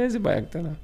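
high-pitched retort, imitating father; imitated alarmed query. Father questioning his son about the small problem, imitated high alarm. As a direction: echo it back as a mock startled question.

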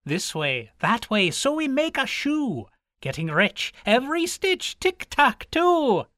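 high, sing-song Irish leprechaun voice; merry high Irish fairy chant; leprechaun's song. Acted. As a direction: chant it like a cobbler elf at work. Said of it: "I do use an Irish accent at times".

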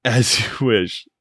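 stretched-out, drawn movie-line echo, with a changed voice; slow savoring echo. Retold or imitated. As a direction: linger on each word like the film's hero. Echoing the famous movie line.

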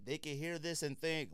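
ordinary talk, nothing marked

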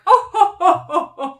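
high, loud hammed-up chuckle; mock chuckle. The council laughing off Kirk's question, in a hammed-up chuckle. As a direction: chortle like a smug villain.

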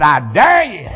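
a loud, laughing I dare you. Retold or imitated. Preacher retelling his wife's dare, laughing loudly. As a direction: shout it through a laugh. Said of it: "I mean, she said".

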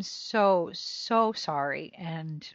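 slow tearful apology; drawn out through real tears. Real, not acted. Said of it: "I'm already crying".

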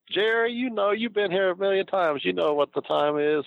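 high, teasing Eddie voice, slowing at the end; Eddie ribbing. Retold or imitated. Eddie teasing Jerry about the time, high mock voice. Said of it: "So he goes".

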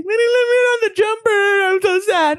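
put-on high, quick little-kid whine; hammed-up childish sadness; mock sad child. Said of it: "That's still emotion of sad".

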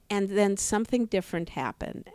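usual speaking voice

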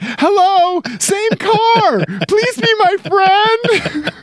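high-pitched, desperate hammed-up yell; maniacal yelling. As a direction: yell like an over-eager maniac begging for company. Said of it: "wave maniacally while yelling".

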